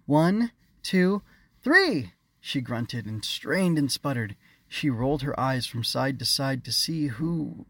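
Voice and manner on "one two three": high, loud countdown; counting out loud. A narrator's reading. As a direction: shout the count. Counting down to unfreeze.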